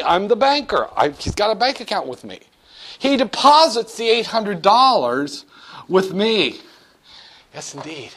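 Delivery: ordinary talk, nothing marked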